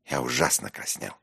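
plain reading voice

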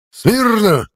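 sharp high parade bark. A narrator's reading. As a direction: bark the command at attention. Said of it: "рявкнул он".

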